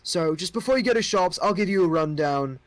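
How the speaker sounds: a curious king asking outsiders; young king. Acted. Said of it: "like, goes".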